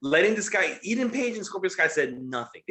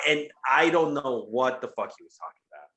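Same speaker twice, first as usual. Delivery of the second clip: usual speaking voice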